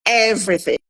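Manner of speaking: usual speaking voice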